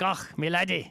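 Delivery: mock Scottish brogue; Scottish accent. Shown as a demonstration. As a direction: toss off a hammy Scottish accent. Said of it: "I could do a Scottish accent".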